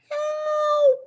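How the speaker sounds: very high, loud squeak of panic. A narrator's reading. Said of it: "I squeaked".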